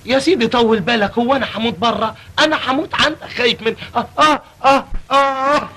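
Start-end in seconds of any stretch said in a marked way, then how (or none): 4.2-5.7 mock dying groans. Feigned dying man groaning.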